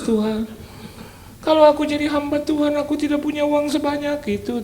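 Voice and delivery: a preacher reenacting his own night prayer; imitated prayer. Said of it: "Terus saya pikir gini".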